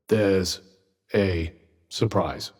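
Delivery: low, slow, unimpressed deadpan; dry sarcasm. Acted. Companion mocking Frey with there's a surprise, dry sarcasm. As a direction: drop it low and drag it out.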